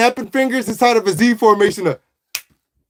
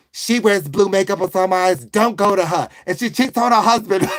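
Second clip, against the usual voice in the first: put-on Italian-American waitress; Italian woman; 2 semitones lower than usual. Waitress steering a customer away from Debbie, Italian woman's accent. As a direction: play a chatty Italian-American woman taking orders. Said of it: "Okay, an Italian woman".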